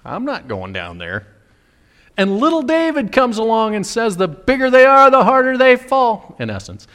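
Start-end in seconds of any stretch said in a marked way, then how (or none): 0.0-1.3 low, quiet timid refusal; mock-cowardly king, hushed and low; cowering. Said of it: "He's cowering in his tent saying". 4.2-6.3 high, loud confident boast; plucky underdog's battle cry; cocky young hero. Acted. Little David's confident boast.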